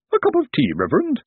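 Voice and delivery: very high-pitched lady's voice; solicitous plump lady. A narrator's reading. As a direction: offer it sweetly in a high voice. Woman offers tea in narrated story.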